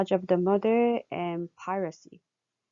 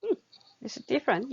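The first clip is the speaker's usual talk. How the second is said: ordinary talk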